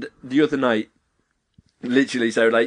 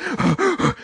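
imitated high, puffing breaths; mock competitive panting. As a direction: pant theatrically for show. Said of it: "those two are both like".

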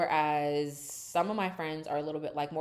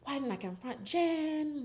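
mock Lisa; 7 semitones higher than usual. Lisa telling Angie she should have stood up. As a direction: play her as guilty and drawn out. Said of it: "Lisa's like".

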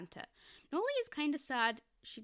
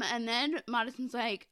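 normal talking voice

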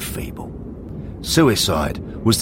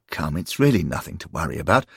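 usual speaking voice

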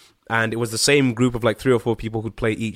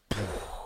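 deflated sigh; 4 semitones lower, 14 dB quieter than usual. Real, not acted. Deflated after the bartender's remark.

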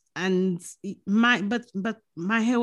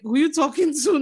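higher, louder, rude comeback; cheeky schoolgirl talking back to the principal; rude teen comeback; 6 semitones higher, 4 dB louder than usual. Retold or imitated. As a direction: look him up and down and say it. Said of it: "watching him up and down like".

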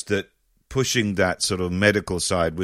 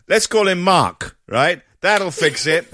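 pleased parents; 7 semitones higher, 4 dB louder than usual. Retold or imitated. Parents voiced deciding son's name.

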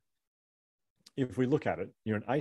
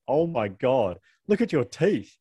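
higher and louder, a jeering schoolboy; mocking kid; 4 semitones higher, 6 dB louder, much slower than usual. Retelling the boy who mocked his teeth, jeering. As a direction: sneer it out like a cruel kid.

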